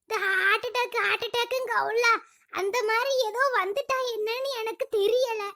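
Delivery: soft, feeble complaint; weak and ailing. Acted. Sick wife lists symptoms to husband, weak and soft. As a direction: plead quietly as if too sick to talk.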